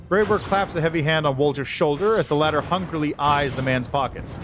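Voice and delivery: usual speaking voice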